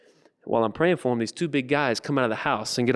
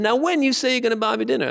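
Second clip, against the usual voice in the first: high, quick questioning voice; eager beggar; 11 semitones higher than usual. Acted. Homeless man follows up on a dinner offer, high quick eagerness. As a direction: ask fast and high, suddenly interested.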